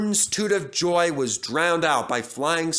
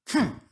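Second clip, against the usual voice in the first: sniff; 10 semitones higher than usual. A narrator's reading. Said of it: "sniffed Henry".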